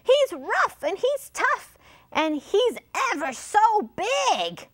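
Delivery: high-pitched parrot warning; dramatic high parrot voice; storybook parrot. A narrator's reading. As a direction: build it up high as an alarmed parrot. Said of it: "asked the parrot".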